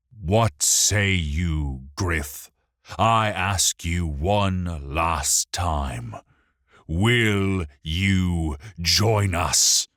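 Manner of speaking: slow, loud, booming demand. A narrator's reading. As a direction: boom it out with weight on each word. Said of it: "Alaron boomed".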